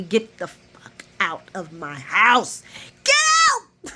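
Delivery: mock screaming get out; furious shout. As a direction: throw her out of the house, screaming. Carly throwing Nina out.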